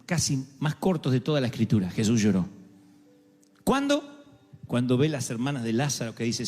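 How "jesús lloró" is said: solemn low verse. Real, not acted. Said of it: "repeated verse".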